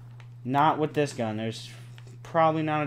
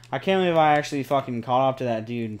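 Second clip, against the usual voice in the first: ordinary delivery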